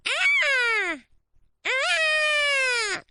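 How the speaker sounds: high, loud, drawn-out screech; imitated creature shriek. Imitating the noise heard from the patio, piercing shriek. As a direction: mimic a terrifying animal scream. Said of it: "And the noise was like".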